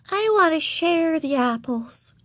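put-on high, slow, sugary little-girl voice; sweetly. A narrator's reading. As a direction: offer it with syrupy innocence. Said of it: "she said sweetly".

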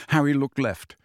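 plain reading voice

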